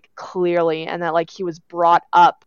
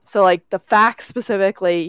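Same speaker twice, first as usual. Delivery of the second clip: normal talking voice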